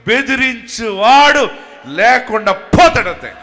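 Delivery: full preaching shout. Real, not acted. Preacher proclaiming the oppressor gone, shouting. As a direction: cry out the promise.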